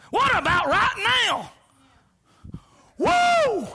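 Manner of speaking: high loud preacher's whoop; excited shout. Real, not acted. Preacher celebrating salvation today, high excited shout. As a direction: burst out in joy.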